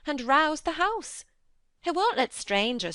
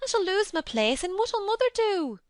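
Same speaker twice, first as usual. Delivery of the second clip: much higher, louder Yorkshire panic, near tears; scared of losing her job; half crying; 13 semitones higher, 4 dB louder than usual. A narrator's reading. Martha frightened she'll be blamed, half in tears. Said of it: "half crying".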